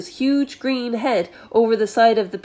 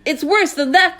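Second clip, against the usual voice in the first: higher alarmed exclamation; fearful cry; 8 semitones higher than usual. A narrator's reading. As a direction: cry it out in dread. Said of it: "cried the chief of police".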